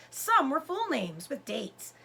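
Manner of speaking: ordinary delivery, nothing marked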